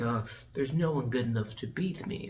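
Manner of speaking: low, soft imitation; smug chess-player villain. Richelieu playing chess against himself, low smug voice. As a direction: murmur it like a self-satisfied villain. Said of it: "playing chess against himself".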